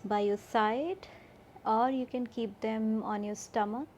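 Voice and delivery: slow, spacious sleep-meditation voice with long pauses; guided meditation. Acted. Yoga teacher guiding listeners to relax and fall asleep, guided meditation. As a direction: guide them gently toward sleep, drawing out key words.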